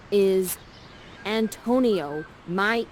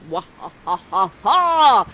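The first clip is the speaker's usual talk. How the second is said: loud hammed-up villain laugh; evil cackle; 8 dB louder than usual. Prospera throwing her hands to the sky, loud evil cackle. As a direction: cackle like a storybook sorceress. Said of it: "You do an evil cackle".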